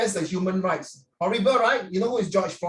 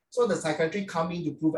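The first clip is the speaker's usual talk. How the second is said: normal talking voice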